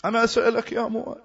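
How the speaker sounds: usual speaking voice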